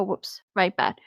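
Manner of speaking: ordinary delivery, nothing marked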